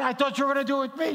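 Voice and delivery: high, fast comic indignation; retold mock protest. Preacher retelling being passed over for mother-in-law, mock indignant.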